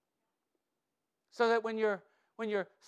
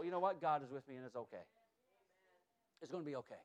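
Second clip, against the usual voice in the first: much softer and lower, gently calming; near-whispered comfort, much softer; soft reassurance; 8 semitones lower, 10 dB quieter, faster than usual. Pretend. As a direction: drop to a hush and soothe them. Soft reassurance after preaching.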